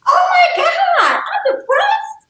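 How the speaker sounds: high, slow, laughing mock shout of realization; mock shocked wail. Retelling her reaction to the counselor, mock shocked wail. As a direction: ham up the shocked discovery.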